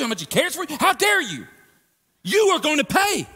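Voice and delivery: loud, raging threat to the sinner; furious Jesus. Acted. Jesus confronting those who dishonored his Father, furious shout. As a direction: thunder it out as an outraged son defending his father. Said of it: "for Jesus to go".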